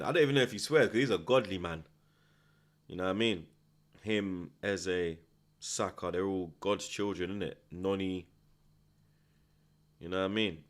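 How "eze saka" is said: ordinary talk, nothing marked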